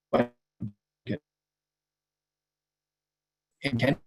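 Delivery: choppy bad connection; breaking up. Real, not acted. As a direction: voice cutting in and out. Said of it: "you're breaking up a bit".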